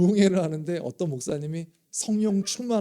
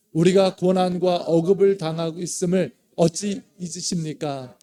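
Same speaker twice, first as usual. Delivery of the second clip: louder, pleading call to God; loud scripture cry; 5 dB louder than usual. Acted. Pastor leads congregation in loud pleading psalm reading. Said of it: "같이 한번 읽습니다. 시작".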